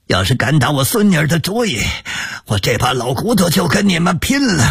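put-on high, furious old man; angry. A narrator's reading. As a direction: shout back in outrage. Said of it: "邹一勺愤怒地从炕上跳了起来".

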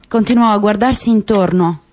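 plain reading voice

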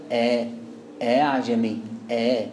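آه عجمي with the hamza softened into a glide; low, level recitation of آه عجمي without a hard catch; eased hamza. Shown as a demonstration. Teacher modelling how it is read, low eased hamza in آه عجمي. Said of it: "تت-، تتقري كده".

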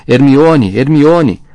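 loud, high bellow, repeated; desperate bellowing. A narrator's reading. Rony screaming for tortured Hermione, desperate bellowing. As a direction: bellow the name with all your force. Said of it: "Rony tornou a berrar".

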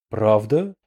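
ordinary delivery, nothing marked

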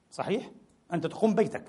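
normal talking voice

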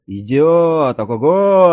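loud, high, stretched-out holler; excited lookout's shout; yelling from aloft. A narrator's reading. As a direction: bellow the sighting down to the crew. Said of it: "орал сверху Олафсон".

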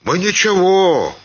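embarrassed peasant. A narrator's reading. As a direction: back down in confusion. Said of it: "сконфузился маленький мужик". (сконфуженно оправдываясь)

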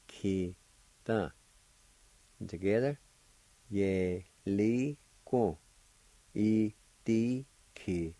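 "ye, li, i, ki, da" each syllable apart, then joined. Shown as a demonstration. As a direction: teach the syllables slowly and clearly. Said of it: "First part is pronounced like this".

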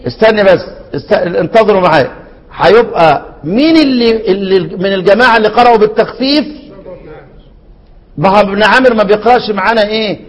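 ordinary delivery

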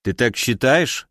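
ordinary delivery, nothing marked

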